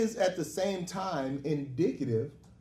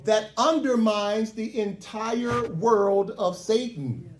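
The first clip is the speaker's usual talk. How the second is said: building preaching cadence, louder and higher; rising preaching; 4 semitones higher, 5 dB louder than usual. Acted. Preacher builds to full force.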